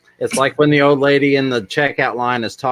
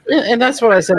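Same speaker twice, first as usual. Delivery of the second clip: laughing; 14 semitones higher, faster than usual. Real, not acted. Goofing after chat remark.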